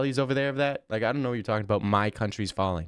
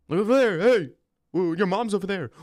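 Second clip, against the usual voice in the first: high, louder hammed-up distraction; pretend trick; 8 semitones higher, 4 dB louder than usual. Streamer hamming up a distraction trick, high and louder. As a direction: overplay the fake alarm. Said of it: "simple tricks".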